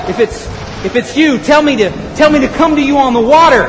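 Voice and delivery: calling out. Acted. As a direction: call out urgently over the storm. Peter calling to Jesus in storm.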